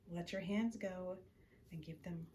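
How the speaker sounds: usual speaking voice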